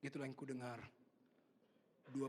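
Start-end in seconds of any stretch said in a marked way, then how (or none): none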